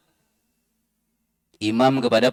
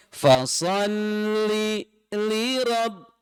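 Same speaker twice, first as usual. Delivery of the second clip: "li" cut off before "rabb", chanted slowly; chanted with a forbidden break; wrong pause; 6 semitones higher, 4 dB quieter, much slower than usual. Shown as a demonstration. Teacher showing the forbidden saktah again. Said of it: "Nggak boleh".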